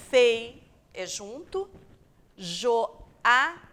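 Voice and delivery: Fei and joada sounded out slow and loud; syllables split. Shown as a demonstration. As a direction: break feijoada into fei and jo-a-da. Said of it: "é separado o o do a".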